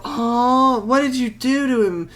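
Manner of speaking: high-pitched pretend concern; mock sympathetic sister. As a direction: imitate a sister gasping over her little brother. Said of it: "she didn't go".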